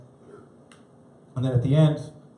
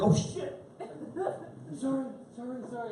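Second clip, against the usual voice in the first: embarrassed apology; 10 semitones higher, 11 dB quieter than usual. Retold or imitated. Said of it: "were just like".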